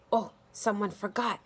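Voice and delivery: ordinary delivery, nothing marked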